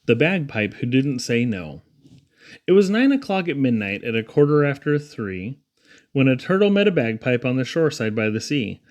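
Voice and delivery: ordinary delivery, nothing marked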